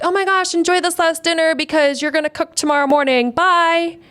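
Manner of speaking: high, breezy TV-host send-off; imitated chirpy host. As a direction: toss it off brightly like a host leaving. Imitating the TV host's breezy announcement.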